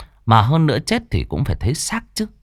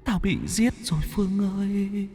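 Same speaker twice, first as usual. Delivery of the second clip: gloomy ghost plea; 8 semitones higher, 7 dB quieter than usual. Acted. Ghost My pleading with Phương to save her, eerie and soft. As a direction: plead like a lost spirit. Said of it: "Cái bóng vẫn cứ ủ rũ".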